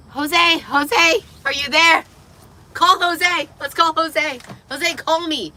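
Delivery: high, loud urgent calls; calling out. Real, not acted. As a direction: call out for a friend to pick up. Calling for Jose on call.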